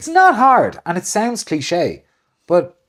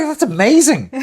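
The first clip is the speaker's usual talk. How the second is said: high, louder mock delight; 10 semitones higher, 6 dB louder than usual. Man thrilled by a stranger's compliment, high delight. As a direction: gush with happy surprise. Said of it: "I'd be like".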